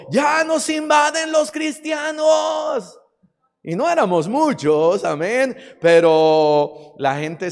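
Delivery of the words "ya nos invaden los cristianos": high, drawn-out holler; mock cry of alarm called from above; imitated shout. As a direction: yell it out high and slow, imitating an angry neighbour. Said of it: "señora desde arriba gritó".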